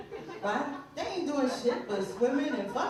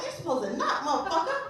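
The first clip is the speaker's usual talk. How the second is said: higher, exasperated telling-off; mock parental scolding; 8 semitones higher than usual. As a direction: scold an intruding kid, playing it for laughs. Parent scolds child barging in.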